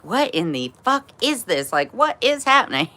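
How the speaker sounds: put-on shocked teen asking what's going on; retold shock. Retelling her shock at her mom's cocaine. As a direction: pitch jumps high in baffled disbelief.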